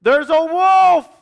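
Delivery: high, drawn-out yell of alarm; crying wolf. A narrator's reading. Shepherd boy up high fooling the community, yelling alarm. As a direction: shout it high and stretch it out. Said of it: "And he said".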